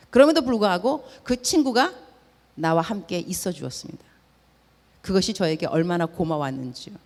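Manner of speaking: normal talking voice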